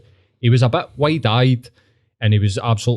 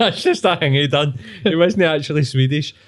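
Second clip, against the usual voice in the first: higher, laughing echo of a bluffer; laughing mimicry; 4 semitones higher than usual. Echoing his mate's Larson bluff, laughing mock voice. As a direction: mimic the claim while cracking up.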